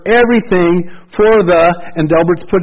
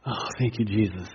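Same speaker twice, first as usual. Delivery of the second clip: hushed thanks; 5 semitones lower, 12 dB quieter than usual. Real, not acted. Soft aside of thanks.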